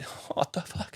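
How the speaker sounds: voiceless, baffled aside; retold hushed astonishment; stunned whisper. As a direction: whisper it in mock disbelief. Acting his stunned inner reaction.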